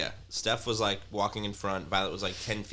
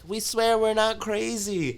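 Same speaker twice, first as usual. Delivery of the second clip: high, slow, louder mock plea; pleading girlfriend; 13 semitones higher, 5 dB louder, slower than usual. Neighbor's girlfriend at the door, high slow mock plea. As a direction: swear it a bit too earnestly. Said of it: "she's like".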